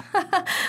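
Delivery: short high amused laugh; a burst of high laughter at the joke. Acted. Couple mocking neighbor's crafts.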